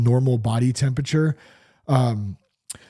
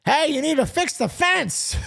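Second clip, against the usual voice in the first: high nagging wife voice; mock the wife asking again. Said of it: "your wife has been asking you".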